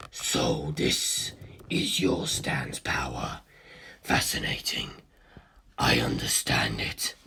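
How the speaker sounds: low, slow whispered monster voice; cold ancient being. A narrator's reading. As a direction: breathe the question in calm interest. Cars voice.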